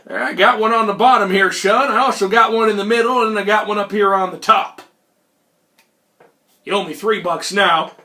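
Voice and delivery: put-on Old Man McGillicuddy. Old hustler showing the cards, brazen character voice. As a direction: brush him off like an old card sharp. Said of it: "Mister McGillicuddy".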